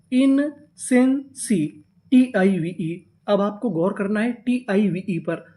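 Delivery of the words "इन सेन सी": "इन सेन सी" very slow in three parts; syllable by syllable. Shown as a demonstration. Teacher adds the next syllable, "इन सेन सी" slow and separated. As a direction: leave a gap after each piece of "इन सेन सी". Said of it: "इतनी meaning क्या बनी".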